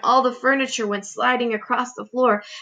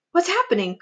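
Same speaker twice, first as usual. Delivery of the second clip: high, alarmed question; startled cry; 8 semitones higher than usual. A narrator's reading. Boy leaping from his hammock, startled cry. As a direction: wake up confused and call out. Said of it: "cried James".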